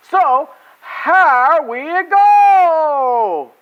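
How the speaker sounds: slow, loud, high here we go; drawn-out kickoff. Acted. Rabbit launching the episode, slow loud and high. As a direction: stretch it out with excitement.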